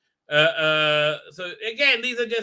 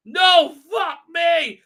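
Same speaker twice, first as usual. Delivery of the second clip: slow, much higher, louder terrified yell; mock scream; 11 semitones higher, 8 dB louder, much slower than usual. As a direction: yell it out in fake terror. Heroine screams at the statue.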